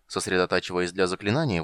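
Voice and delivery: ordinary delivery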